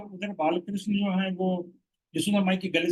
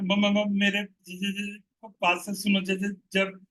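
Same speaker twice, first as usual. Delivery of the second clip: mocking child's stutter, repeating first sounds; playfully ape the stammering boy; slowed, teasing stutter; children copying a stammer; slower than usual. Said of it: "ऐसे सब बच्चे बोलने लगे".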